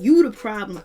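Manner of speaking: a sudden loud burst on one line; snapping back, loud and sharp; retold snap. Willie snapping back on the show.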